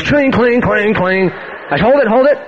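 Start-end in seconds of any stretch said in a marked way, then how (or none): 0.0-1.3 high, clanging piano noises; imitated piano clanging. Preacher mimicking the lady at the piano, comic clanging. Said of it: "She goes". 1.9-2.4 higher, urgent call to stop; exasperated interruption. Retold or imitated. Preacher halting the pianist, exasperated interruption.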